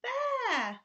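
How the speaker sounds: high, loud panicked cry; the bees cry out in terror; frightened cry. Acted. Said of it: "they cried".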